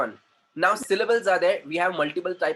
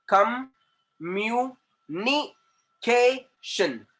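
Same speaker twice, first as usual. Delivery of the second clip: "communication" very slow and higher, in separate beats; syllable by syllable; 5 semitones higher, much slower than usual. Shown as a demonstration. Teacher showing where syllables form, "communication" slowly chopped apart. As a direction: pause after each syllable of "communication". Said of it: "जहां पे sound change होगा".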